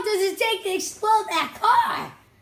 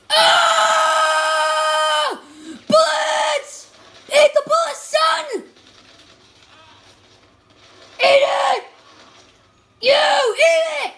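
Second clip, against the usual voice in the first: high, loud shouting; battle yell; 8 semitones higher, 4 dB louder, slower than usual. Real, not acted. Gamer shooting at the enemies, yelling high and loud. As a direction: roar it as you open fire.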